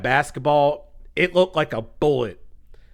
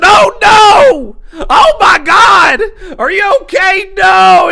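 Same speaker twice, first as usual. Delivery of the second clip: very high, loud panicked cry; mock horror; 12 dB louder, much slower than usual. Himself to the girls under the glass, mock horror. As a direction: wail it in hammed-up alarm. Acts out his horrified reaction.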